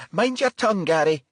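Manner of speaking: high, sharp motherly scolding; admonishing. Acted. Mother scolding her grown son in front of police, sharp high scold. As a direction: scold him for his language. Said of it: "Missus Bright admonished".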